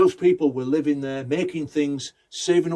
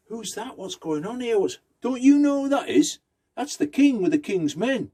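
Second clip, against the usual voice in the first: excited townsman; 9 semitones higher than usual. Acted. Traveller explains the king's arrival.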